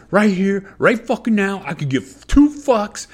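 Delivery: retold hotheaded outburst. Acts out his old hotheaded self.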